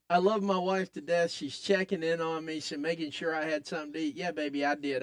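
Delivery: ordinary delivery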